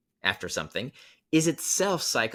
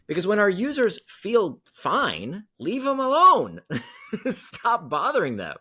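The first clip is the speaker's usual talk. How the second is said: playful punchline; 8 semitones higher, slower than usual. Real, not acted. Punchline advice, laughing.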